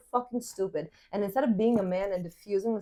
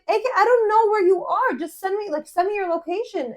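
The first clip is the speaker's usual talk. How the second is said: re-enacted exasperation, much higher voice; exasperated driver; 9 semitones higher, 5 dB louder than usual. Lost driver arguing with friend on the phone, exasperated. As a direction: plead in frustration, pitched up high.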